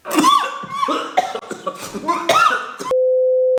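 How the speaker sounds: hacking cough breaking into laughter. Real, not acted.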